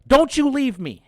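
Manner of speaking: high, loud imitated girlfriend screaming; girlfriend's shrill yell. Girlfriend yells after Billy running off, high angry scream. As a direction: shriek it like the abandoned girlfriend. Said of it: "She's like".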